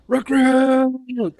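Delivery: high, loud yell across a hall; drill sergeant shout. Retold or imitated. Drill sergeant calling across the D fac, loud yell.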